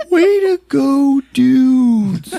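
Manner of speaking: high, slow put-on jeer; whiny brother. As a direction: drag it out in a mock kid voice. Acting Melvin's sarcastic protest.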